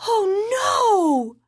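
put-on high, anguished wail of a young girl. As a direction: cry it out like a heartbroken child. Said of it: "Keiko wailed".

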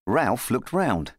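usual speaking voice

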